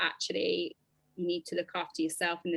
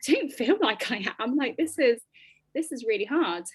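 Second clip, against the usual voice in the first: raised, louder, pleading disbelief; distressed protest; 4 semitones higher, 3 dB louder than usual. Retold or imitated. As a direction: push back, high and loud, overwhelmed. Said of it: "and I was like".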